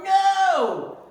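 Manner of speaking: very loud, high yell. Acted. Father yelling at the cashier, loud yell. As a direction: yell it back. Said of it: "The father yelled".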